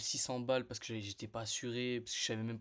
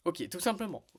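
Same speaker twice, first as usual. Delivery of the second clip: acted ok; 6 semitones higher, 5 dB louder than usual. Acting his calm answer to bosses.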